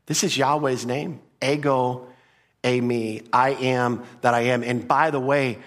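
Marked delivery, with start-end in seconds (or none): none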